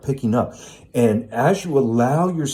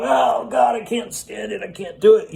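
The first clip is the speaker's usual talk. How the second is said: mock panicked outcry; 11 semitones higher than usual. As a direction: cry out like you can't bear it, hammed up. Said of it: "you go".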